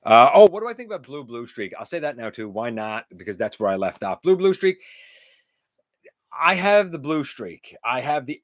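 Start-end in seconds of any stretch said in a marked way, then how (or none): none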